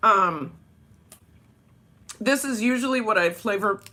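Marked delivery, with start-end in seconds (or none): none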